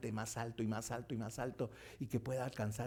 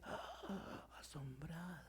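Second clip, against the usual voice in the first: hushed gasp; 5 semitones higher, 12 dB quieter, much slower than usual. Acted. Frogs stunned.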